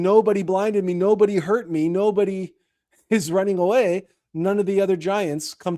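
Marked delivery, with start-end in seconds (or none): 0.0-4.1 mild mock shout, pitched higher; retold shouting. Cyclops calling for help, mild mock shout. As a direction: lightly replay the giant's cry. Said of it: "because he's shouting".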